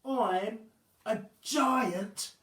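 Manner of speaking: high, loud and very slow lament; self-pitying giant. Acted. Wobbling telling the boy she is hideous, high and slow. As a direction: drag out her sorrow.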